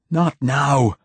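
high loud scream. A narrator's reading. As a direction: scream the refusal. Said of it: "screamed the poet".

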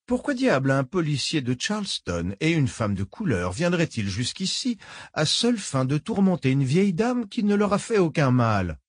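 put-on genteel old woman, falsely kind. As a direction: purr it like a polite old villain. Old woman feigns innocence.

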